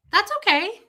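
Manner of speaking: high, light brush-off; airy high okay; breezy okay. Retold or imitated. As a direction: sing it off, unbothered. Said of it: "But I just went".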